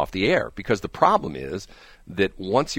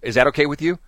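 ordinary talk, nothing marked

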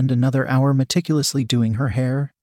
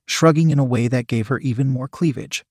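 plain reading voice

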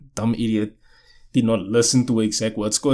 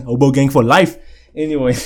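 normal talking voice

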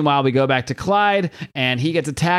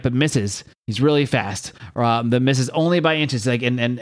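ordinary delivery

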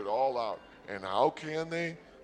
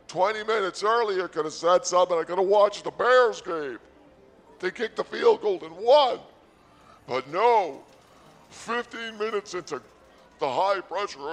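loud exasperated rant; 5 semitones higher, 9 dB louder than usual. Acted. Ranting about missed game.

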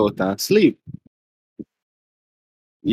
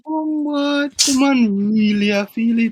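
mock shock; 10 semitones higher, slower than usual. Retelling shocked reaction.

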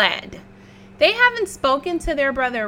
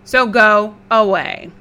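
defiant pig voice; 9 dB louder than usual. Acted. Red dismisses wolf.

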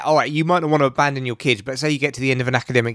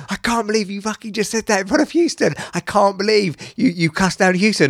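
high, excited disbelief; mate's shocked voice; 6 semitones higher than usual. Retold or imitated. Mate reacting to seeing Houston, high and shocked. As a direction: gasp it out like a stunned mate. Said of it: "and he goes".

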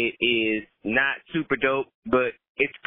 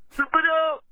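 catchphrase shout; 3 dB louder than usual. Real, not acted. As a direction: belt out the show's name. Caller's sign-off catchphrase.

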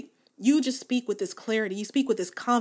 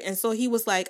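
ordinary delivery

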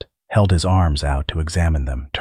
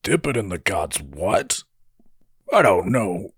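disgusted; 3 semitones higher than usual. A narrator's reading. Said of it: "Darl made a disgusted sound".

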